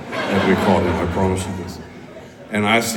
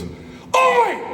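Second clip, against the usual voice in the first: much higher shouted Scottish oi; hammed-up yell at the guy who sold bunk molly; mock yell. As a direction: bark it across the street. Lloyd yelling at dealer.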